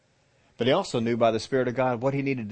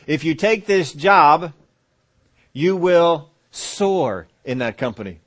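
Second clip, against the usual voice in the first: louder and slow, like a divine promise; slow booming promise; 5 semitones higher, 9 dB louder, much slower than usual. Acted. The Lord promising the doubter, loud and slow. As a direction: proclaim it big and slow.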